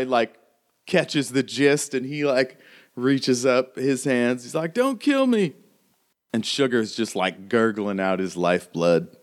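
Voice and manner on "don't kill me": high, pleading cry; frightened begging. Acted. The other guy raises his hands and begs, frightened high plea. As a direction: plead in a fearful raised pitch. Second gunman surrenders, begging.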